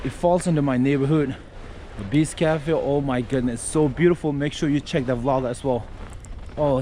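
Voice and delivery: ordinary talk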